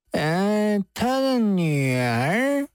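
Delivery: put-on slow, stretched-out drunken query; tipsy. Drunk man puzzled by the judge's question, tipsy. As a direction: drag the question out in a boozy haze. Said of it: "宗离醉意朦胧地说道".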